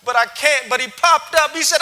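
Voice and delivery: ordinary talk, nothing marked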